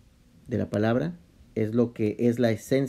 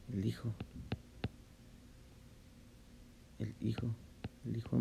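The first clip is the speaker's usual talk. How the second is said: much softer, almost breathed; hushed reverence; 16 dB quieter than usual. Real, not acted. Reverently naming the Son. (reverente y suave)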